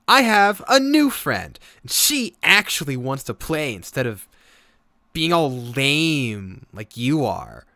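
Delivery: put-on high, loud, slow taunting child; bratty little girl. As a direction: rub it in like a smug kid. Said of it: "She goes".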